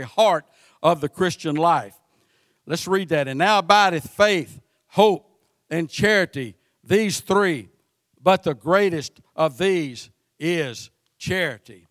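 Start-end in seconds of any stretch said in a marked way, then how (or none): 3.3-11.9 scripture reading. Acted. Reads scripture aloud.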